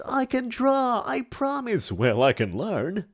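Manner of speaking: high, pleading theatrical voice; hammy stage actor. Acted. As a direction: beg dramatically. Pleading in character.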